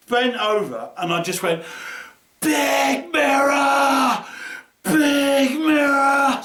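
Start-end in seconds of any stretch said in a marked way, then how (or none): 2.4-6.4 high, slow, drawn-out scream; a long triumphant scream, high and loud. Retold or imitated. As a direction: scream it out in pure joy. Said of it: "Just screaming it".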